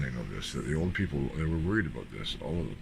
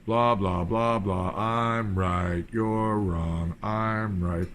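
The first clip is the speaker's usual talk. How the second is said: loud, sneering mock of her bickering; mocking imitation; 3 semitones higher, 5 dB louder, much slower than usual. Mocking the woman from Etobicoke's reply, loud and sneering. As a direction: parrot her smug reply back loudly.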